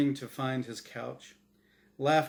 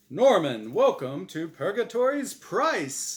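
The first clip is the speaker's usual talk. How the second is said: loud, higher showman's welcome; glib game show host; 6 semitones higher, 8 dB louder than usual. Acted. Angel host welcoming the dead contestant, glib announcer. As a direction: greet him like a TV host. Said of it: "his voice deep and glib like a radio announcer".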